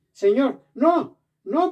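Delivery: loud, high acted protest; emphatic refusal. Preacher voices Pedro refusing the heavenly command, emphatic protest. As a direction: cry out in refusal, pitch leaping.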